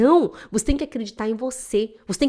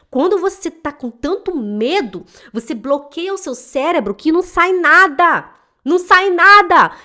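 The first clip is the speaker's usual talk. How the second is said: builds higher and louder to a near-shout, slowly; rising exasperation; 6 semitones higher, 8 dB louder than usual. Real, not acted. Teacher stressing how fear blocks the mind, building loud. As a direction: drive it up to NADA.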